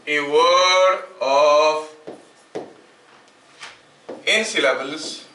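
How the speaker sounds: very slow, drawn-out dictation; note dictation. Shown as a demonstration. Teacher dictating a definition for students to write, slow dictation. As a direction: dictate word by word with long pauses.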